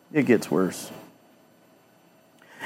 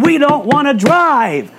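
higher, sneering mock voice of opponents; mocking quote; 7 semitones higher than usual. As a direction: put words in their mouths with scorn. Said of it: "They're saying".

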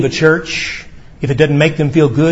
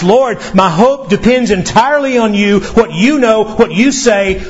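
raised, fervent appeal; earnest cry of reliance; throwing himself on Christ; 6 semitones higher than usual. Acted. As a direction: plead with total dependence, pitched up. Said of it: "Peter here throws himself on Christ".

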